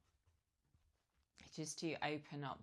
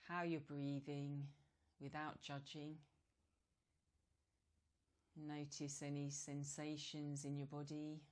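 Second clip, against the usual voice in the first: guided meditation. Real, not acted. Yoga teacher guiding relaxed breathing, calm meditation voice. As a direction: ease them gently into stillness, leaving space between phrases.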